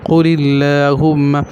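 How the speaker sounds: قل اللہم slow, with a light "lilla"; thin l. Shown as a demonstration. Teacher repeating the rule, light l in قل اللہم. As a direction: say both l sounds in قل اللہم thin and clear. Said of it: "لام بھی باریک".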